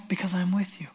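soft reassuring whisper. Acted. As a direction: breathe it like a secret promise. God's reassuring promise, whispered.

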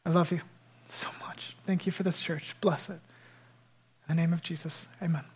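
whispered amen; hushed prayer. Real, not acted. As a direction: close the prayer intimately. Intimate end of prayer.

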